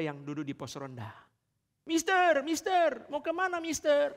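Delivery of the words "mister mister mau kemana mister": high-pitched youths calling to the foreigner. Acted. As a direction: shout high after the passing foreigner. (pemuda kampung memanggil bule dengan suara tinggi)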